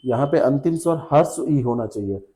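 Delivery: normal talking voice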